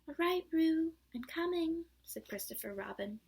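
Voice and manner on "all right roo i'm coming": higher, much louder call. A narrator's reading. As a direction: shout reassurance across the stream. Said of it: "calling out".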